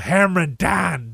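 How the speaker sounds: put-on Yinzer accent; thick Pittsburgh accent. Shown as a demonstration. Radio host showing how Pittsburghers talk, thick Yinzer accent. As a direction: lean into broad Pittsburgh vowels. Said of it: "Pittsburgh don't have a thick accent".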